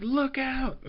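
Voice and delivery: high warning cry; mock warning. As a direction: call out high and urgent. Voicing her warning shout.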